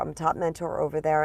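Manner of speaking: usual speaking voice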